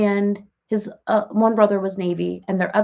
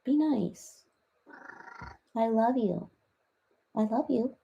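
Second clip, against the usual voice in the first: cooing to the cat; 3 semitones higher, 8 dB quieter than usual. Real, not acted. Coaxing her cat to be nice, soft and cooing.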